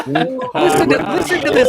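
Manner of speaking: cracking up while teasing; laughing. Real, not acted. Teasing friend's name dropping.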